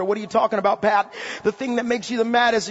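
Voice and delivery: ordinary talk, nothing marked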